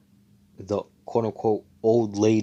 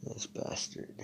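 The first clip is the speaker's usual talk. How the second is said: soft whispered grumble after a sigh; whispered curse; 12 dB quieter, slower than usual. Retold or imitated. Seke annoyed at Asta, whispering a curse. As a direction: mutter it under the breath, fed up. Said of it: "Seke's like".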